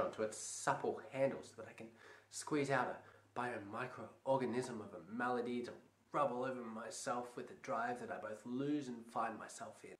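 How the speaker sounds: hushed comic ramble. Acted. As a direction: let it sink to a hush.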